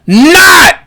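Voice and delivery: high, loud call across the shop; yelled name. Acted. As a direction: shout for the helper from the counter. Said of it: "He yells out".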